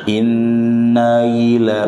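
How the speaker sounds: Inna ila slow, ila opened with a clean glottal stop; firm hamzah. Shown as a demonstration. Teacher modelling correct ila for students. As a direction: clear hamzah, never yila. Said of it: "bukan yila".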